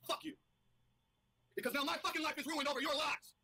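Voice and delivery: angry outburst. Real, not acted. Furious at accusers online.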